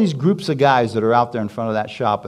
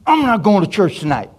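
sulky defiance; 8 semitones higher than usual. Retold or imitated. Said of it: "I was mad at God".

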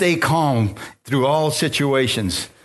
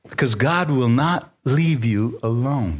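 ordinary delivery

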